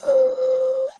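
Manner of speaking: very high, loud "ooh"; high squealed coo. Acted. Guest touched by the honorary title, squealing with delight. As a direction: squeal it like a happy sob. Moved at being made honorary queen.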